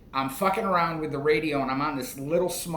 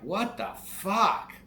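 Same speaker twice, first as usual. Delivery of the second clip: slow, higher, drawn-out what the fuck; stunned disbelief; 5 semitones higher, slower than usual. Retold or imitated. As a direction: let the disbelief stretch each word out. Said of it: "And I'm like".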